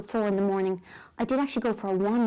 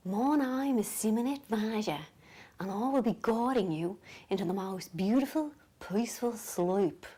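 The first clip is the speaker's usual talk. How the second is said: calm Australian lady; 4 dB quieter than usual. Acted. Lady on a sleep CD guiding listeners, calm Australian accent. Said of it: "She's Australian. And she goes".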